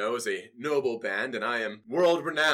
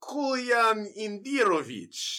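Kolyan Indirovich, much higher and slow in a heavy Russian accent; thick Russian accent; 10 semitones higher, 6 dB louder, slower than usual. Shown as a demonstration. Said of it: "Little, little more".

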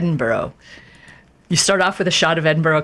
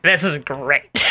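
mock delight; slower than usual. Crowley's delighted reaction retold.

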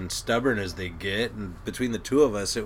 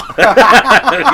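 high, loud real laugh; burst of laughter; 12 semitones higher, 18 dB louder than usual. Real, not acted. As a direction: crack up at it. Laughing at his own punchline.

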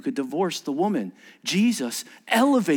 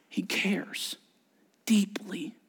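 whisper; 6 dB quieter, much slower than usual. Real, not acted. Tender point in sermon.

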